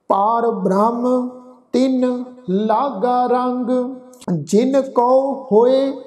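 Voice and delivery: slow, measured recitation; slow gurbani recitation. Acted. Teacher reciting gurbani verse slowly to students. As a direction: recite each word apart, devoutly.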